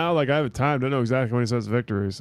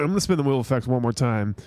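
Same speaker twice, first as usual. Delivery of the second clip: ordinary talk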